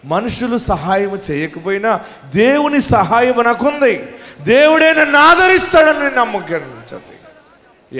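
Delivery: rising fervent preacher's assurance; climactic preaching. Acted. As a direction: soar into the promise of God's help.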